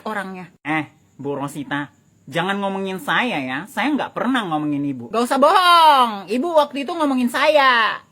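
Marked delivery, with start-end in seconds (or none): 5.1-8.0 loud, sharp accusing retort; angry accusation. Acted. Neighbor confronts gossiping neighbor, loud and angry. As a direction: snap back at the lying neighbor.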